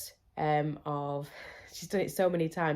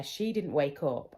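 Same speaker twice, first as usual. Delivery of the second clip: usual speaking voice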